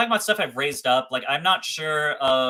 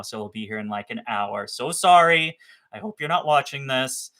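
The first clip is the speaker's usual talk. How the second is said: normal talking voice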